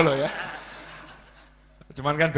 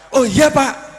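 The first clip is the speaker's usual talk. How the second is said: higher, louder, eager yes; eager clerk; 8 semitones higher, 9 dB louder, slower than usual. Acted. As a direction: snap to it like a scared clerk. Clerk obeys the boss. (karyawan cepat menurut, suara tinggi)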